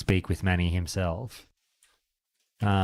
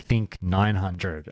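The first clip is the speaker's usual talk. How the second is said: dry salesman; much slower than usual. Retold or imitated. Salesman's dry reply retold.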